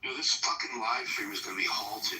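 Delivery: usual speaking voice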